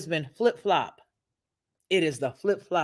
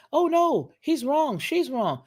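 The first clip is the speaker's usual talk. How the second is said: mock outrage; 8 semitones higher, slower than usual. Preacher mocks quick judges with fake outrage. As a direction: fake shock at who's to blame. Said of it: "opinion to say".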